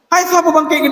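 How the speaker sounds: sudden high startled cry; startled waking. Acted. Risen dead startled at waking, high surprised cry. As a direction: jolt awake in surprise.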